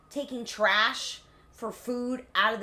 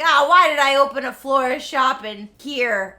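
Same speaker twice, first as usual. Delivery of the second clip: mock lament; 10 dB louder than usual. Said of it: "He's like".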